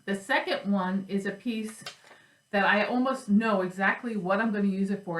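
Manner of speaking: ordinary delivery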